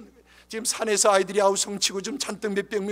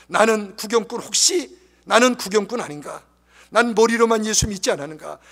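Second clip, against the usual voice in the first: loud, driving altar call; forceful revival preacher's exhortation; full-force preaching; 9 dB louder than usual. Real, not acted. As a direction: press the congregation to decide, loudly.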